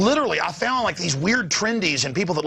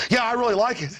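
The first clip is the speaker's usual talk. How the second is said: higher, brisk, laughing sycophant; higher, fast mock approval; mock eager conformist; 4 semitones higher than usual. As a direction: gush like a brainwashed fan. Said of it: "They're like".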